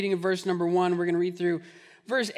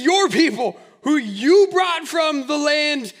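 higher, louder, laughing blame; exasperated God; 6 semitones higher, 5 dB louder than usual. Retold or imitated. God telling Moses the people are his, mock exasperated. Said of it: "He says".

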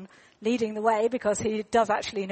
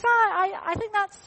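put-on higher, stammering child voice; little boy voice; 9 semitones higher, 5 dB louder than usual. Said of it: "And he goes".

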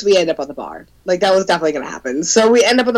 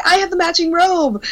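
high-pitched gushing reply; excited imitation; 10 semitones higher than usual. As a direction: play the thrilled stranger topping it. Stranger matches excitement, retold.

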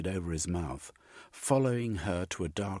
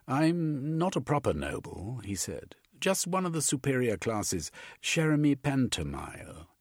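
higher, stiffly polite gent; lightly higher gentleman; 7 semitones higher, 3 dB louder than usual. A narrator's reading. Gentleman introduces himself to cellmate, higher. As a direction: introduce yourself a bit haughtily.

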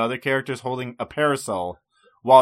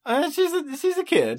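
put-on high childlike voice; 14 semitones higher, 3 dB quieter than usual. Mocking Bulma as a kid.

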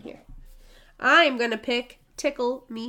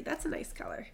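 softly whispered compliment; whispered aside; 14 dB quieter than usual. Real, not acted. Quietly praising friend's pick, whispered. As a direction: breathe it out under the breath.

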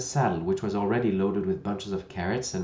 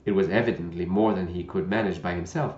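plain reading voice

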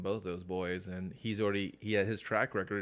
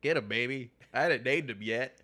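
bragging Jim Lee parody; 5 semitones higher, 6 dB louder than usual. Retold or imitated.

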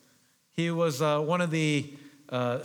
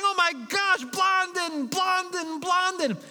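cheering crowd; 13 semitones higher, slower than usual. Retold or imitated. Crowd cheering Blondin after he crosses, high excited shout. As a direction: cheer him on like a wild crowd. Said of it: "They're shouting".

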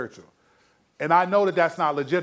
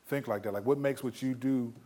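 softer, lower conversational questioning; casual gentle challenge; 5 semitones lower, 5 dB quieter than usual. Shown as a demonstration. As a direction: lightly act out talking it through with a friend. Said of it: "I'll just be like".

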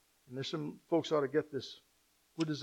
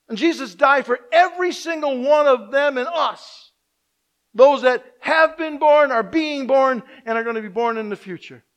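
loud, higher preaching voice; proclaiming loudly from the pulpit; full-force preaching; 8 semitones higher, 12 dB louder than usual. Acted. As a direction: preach it out with fervour.

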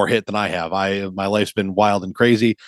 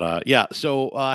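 normal talking voice

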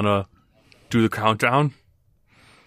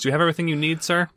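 tiny owner voice; 8 semitones higher, much faster than usual. Acted. Tiny owner addresses customer.

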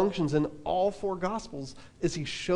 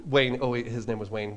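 usual speaking voice

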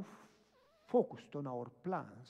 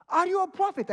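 very high, loud woman's exclamation; astonished question; 16 dB louder than usual. Retold or imitated. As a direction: shriek the question as the amazed lady.

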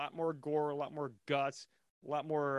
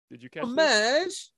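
excited; 8 semitones higher, 11 dB louder, slower than usual. Real, not acted. Excited question.

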